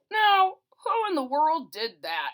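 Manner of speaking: high, loud startled watchman; exclaiming night watchman. A narrator's reading. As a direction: boom out like a surprised guard. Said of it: "he exclaimed".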